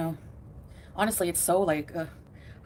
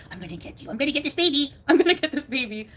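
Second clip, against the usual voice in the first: playful chase voice; 6 semitones higher, 5 dB louder than usual. Retold or imitated. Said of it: "I'll get up and go".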